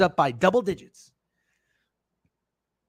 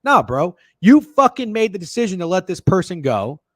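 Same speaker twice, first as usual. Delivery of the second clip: usual speaking voice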